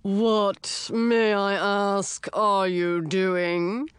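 slow, drawn-out indignant snarl; grumpy octopus snarling at the door. A narrator's reading. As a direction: bite out each phrase, annoyed. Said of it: "a voice snarled".

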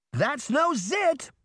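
high, slow, stunned exclamation; shouted. A narrator's reading. Younger sister shocked at the wings, high slow shout. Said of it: "Emma shouted".

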